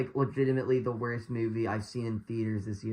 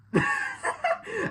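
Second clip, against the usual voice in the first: high burst of laughter; helpless laugh. Real, not acted. Reviewer laughing at terrible dialogue, high burst of laughter. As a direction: crack up at your own impression. Cracks up at the line.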